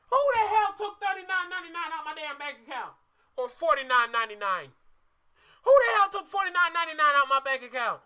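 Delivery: loud, indignant rant about the charge; hammed-up angry yelling; mock outrage. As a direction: play a furious guy checking his bank app. Mock outraged customer over charge.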